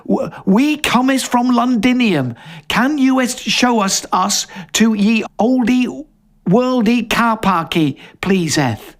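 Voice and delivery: playful put-on old English voice; mock olde English. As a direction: ham it up like a bad reenactor. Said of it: "Oh, I see, very good".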